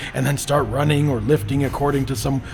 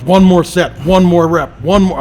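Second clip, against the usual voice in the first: loud, higher shouts of encouragement; gym partner shouting; 6 semitones higher, 11 dB louder than usual. Retold or imitated. Training partner yelling at a lifter to do one more rep, loud. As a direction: bark it like a spotter pushing a lifter. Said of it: "their training partners".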